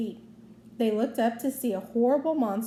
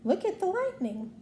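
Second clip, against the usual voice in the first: high goofy exclamation; a lightly voiced dopey cartoon gasp; 8 semitones higher than usual. A narrator's reading. Goofy, awed by the storm, in a light goofy voice. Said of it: "Goofy said".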